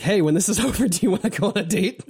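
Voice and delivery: high giggling imitation of a date request; giggly lightly voiced suitor; laughing mock ask-out. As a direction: ask her out while cracking up. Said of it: "Iori's like".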